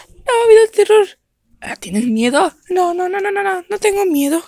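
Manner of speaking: high, quick denials; nervous dog voice. Acted. Dog insists to host it is not scared. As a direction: insist you are not afraid.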